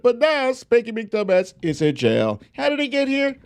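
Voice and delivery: high, sing-song picture-book voice; reading to children. Acted. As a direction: read it like a bedtime storybook for kids.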